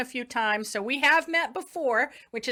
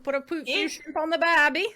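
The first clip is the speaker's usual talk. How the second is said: louder, higher mock Aussie voice; Australian accent; 5 semitones higher, 4 dB louder than usual. Interviewer shows off her impersonation for the guest, hammed-up Australian. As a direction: put on a cheery Australian accent. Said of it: "you should hear her Australian accent".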